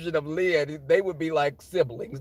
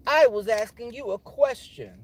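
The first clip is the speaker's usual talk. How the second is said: higher, emphatic mock demand; indignant coworker; 7 semitones higher, 4 dB louder than usual. Coworker demanding an answer to a question, indignant. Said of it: "when she gave me that energy".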